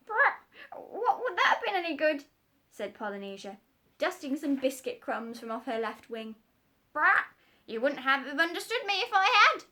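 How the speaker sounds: very high, loud parrot squawk. Acted. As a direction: screech like a bossy parrot.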